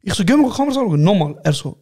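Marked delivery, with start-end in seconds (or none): 0.2-1.0 insistent demand. Retold or imitated. Retold argument, repeated demand.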